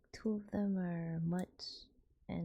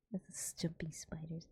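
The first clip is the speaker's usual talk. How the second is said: ASMR whisper. Acted.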